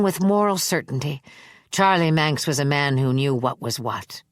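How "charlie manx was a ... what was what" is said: ordinary delivery, nothing marked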